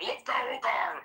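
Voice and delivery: high, forced orc voice; orc war cry. Shown as a demonstration. Showing friends what to say, high orc war cry. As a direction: pitch it up as a Warcraft orc cry. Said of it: "you have to say shit like".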